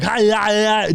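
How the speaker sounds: high, strained imitation yell; mock Arnold scream. Shown as a demonstration. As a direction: scream it in a hammed-up action-star voice. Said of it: "that's screaming like".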